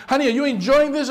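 a husband amused to find his wife enjoying the vacuuming; husband asking. Acted. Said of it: "when your husband tells you".

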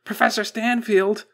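high, loud, alarmed cry; urgent calling out. A narrator's reading. Technician bursting through the door to the professor, alarmed shout.

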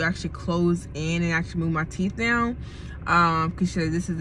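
muffled behind fingers; speaking through covered lips; hand over mouth. Real, not acted. Seen 2: Fingers cover her mouth from about 0.8 to 4.8s, then the hand moves away.

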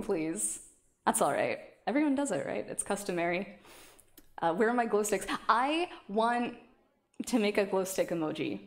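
ordinary talk, nothing marked

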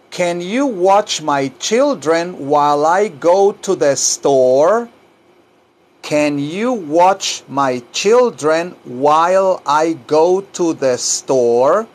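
slow question, carefully enunciated; drawn-out language-lesson question; slow model sentence. Shown as a demonstration. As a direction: separate the words for learners, ending upward. Teacher models sentence for learners.

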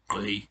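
GOI rough in the throat; strident vowel. Shown as a demonstration. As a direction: constrict the glottis through the vowel of GOI. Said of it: "another word with a, s-, a strident vowel".

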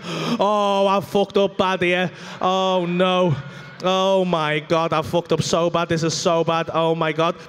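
slow, loud, low wail of regret; hammed-up low moaning dismay; mock despair. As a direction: groan it out heavily, stretching the words. Acts out his panic.

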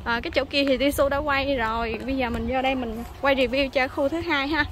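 ordinary talk

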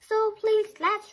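high eager please; pleading. Acted. Chick pleading with Papa to read together, high and eager. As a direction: beg like an excited kid.

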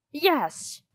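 high, sudden cry of hope. A narrator's reading. Theater owner seeing a way out, crying out. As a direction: exclaim it high, grasping at hope. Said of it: "he cried".

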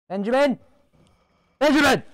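high, rising insistence on the name; calling out. Pretend. Player calling Benjamin to order, high insistent call.

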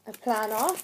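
ordinary talk

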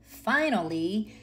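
usual speaking voice

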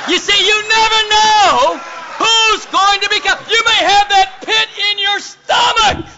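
high, loud, drawn-out preacher's cry; full-force preaching. Real, not acted. Preacher urging church to share despite fear, full-force shout. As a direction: belt it out to the whole congregation.

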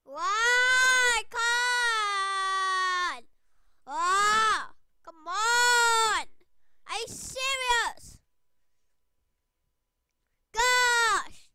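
high, loud drawn-out wails; exasperated screaming. Real, not acted. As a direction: wail and yell at the game in disbelief. Wailing over losing in game.

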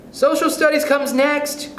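high, drawn-out schoolgirl call; eager cry. A narrator's reading. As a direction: pipe up eagerly, high and slow. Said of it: "cried Elizabeth Gardner eagerly".

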